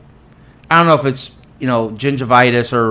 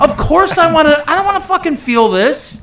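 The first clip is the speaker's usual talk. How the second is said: higher, indignant outburst; exasperated venting; 13 semitones higher than usual. Real, not acted. Telling his friend about the dentist, indignant outburst. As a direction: vent like it's obvious.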